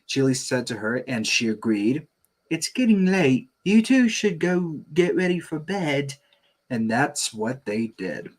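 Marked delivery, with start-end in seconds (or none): 2.5-6.1 gentle mom voice. Acted. Chili sends kids to bed.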